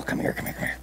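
hushed whisper to the dog; soft whisper calling the dog over; whispered coaxing. Real, not acted. Softly calling the dog close.

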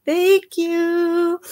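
usual speaking voice

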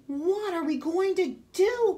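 a mother crying out in despair; distressed cry. A narrator's reading. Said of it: "cried Missus Cream".

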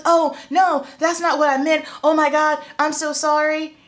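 high, loud mimicry of an apologetic client; imitating panicked backtracking; flustered apology.

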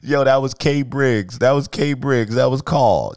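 loud, high, insistent protest; animated, loud setting-the-record-straight; excited correction. Real, not acted. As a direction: cut in and insist, pitch high. Interrupts caller to correct him.

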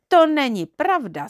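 put-on indignant storybook little girl; frowning denial. A narrator's reading. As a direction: contradict him with a pout. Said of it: "zavrtěla hlavou Modroočka a zamračila se".

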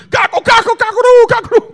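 loud, very high mock bird cries; panicked bird squawk. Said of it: "The bird said".

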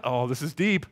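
usual speaking voice

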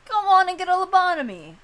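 loud, high pushy doctor voice; mock pushy doctor. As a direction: sell the lobotomy like a huckster. Mock doctor pitching surgery.

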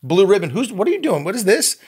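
high, incredulous mock alarm at his son; a retold, hammed-up gasp of disbelief over the beer; shocked dad. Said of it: "I go".

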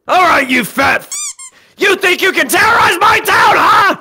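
high, loud confrontation; heroic outrage. Acted. Hero defending my town from villain, loud outraged challenge.

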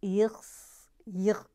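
slow, attempted Dutch accent; rough go at a Dutch accent. Shown as a demonstration. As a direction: put on a phlegmy Dutch voice. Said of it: "if I can do a Dutch accent".

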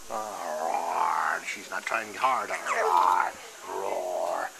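low growling monster roars, played for a toddler. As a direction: growl like a playful tickle monster. Dad playing monster with toddler.

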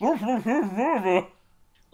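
words broken by a high laugh. Real, not acted. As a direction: laugh through it. Laughing at friend's answer.

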